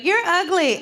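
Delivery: put-on high, blunt kid voice; deadpan little kid calling you ugly; a child's matter-of-fact insult; kid's insult. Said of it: "they'll just walk right up to you and be like".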